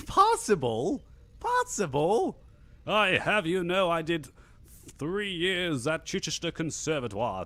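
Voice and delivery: high, affronted, over-enunciated retort; hammed-up wounded pride about drama training; mock indignation. Mock offence at accent being rated passable.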